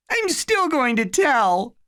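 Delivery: put-on high, small child's voice; a scared little kid, pitched high; little boy voice. A narrator's reading. Little Eddie pleading with his big brother, high childlike voice.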